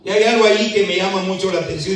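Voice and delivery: ordinary talk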